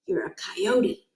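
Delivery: slow, soft whisper; a breathless inner thought. A narrator's reading. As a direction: whisper it to yourself in a crouch. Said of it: "Miles thought".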